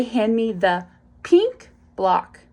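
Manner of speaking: "pink" drawn out with heavy emphasis; stress on pink. Shown as a demonstration. Parent highlighting a word in a one-step direction, stress on "pink". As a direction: lean hard and long on "pink". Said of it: "I put emphasis on the b- word pink".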